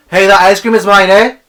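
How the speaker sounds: loud, high mock shout; mock yell. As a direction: yell it like a jokey claim. Mock shout about ice cream.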